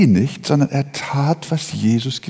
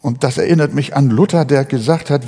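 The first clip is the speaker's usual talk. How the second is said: normal talking voice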